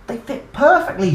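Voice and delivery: loud, high delight; joyful cry. Acted. Dinosaur cries out in joy to everyone. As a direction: burst with joy. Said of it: "she cried".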